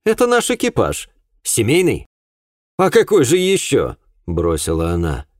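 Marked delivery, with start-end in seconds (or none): 0.0-4.0 high, incredulous retort; exasperated, high-pitched woman's voice; indignant woman. A narrator's reading. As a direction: answer as if to a madman. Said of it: "как на сумасшедшего".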